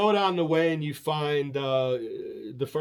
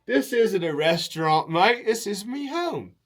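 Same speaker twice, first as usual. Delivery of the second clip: louder, higher mock Aussie reveal; Aussie host; 5 semitones higher, 4 dB louder than usual. Aussie host revealing the restaurant is his home, louder mock Aussie. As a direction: deliver the punchline like a chuffed Aussie.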